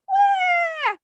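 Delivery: very high mock baby wail; storyteller imitating a crying doll. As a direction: cry like a tiny baby. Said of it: "heard a baby doll crying".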